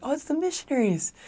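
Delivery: bright-eyed high phone voice; high, extra-friendly caller; kindly high voice. Retold or imitated. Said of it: "my voice goes up".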